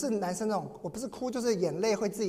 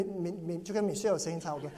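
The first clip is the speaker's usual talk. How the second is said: ordinary delivery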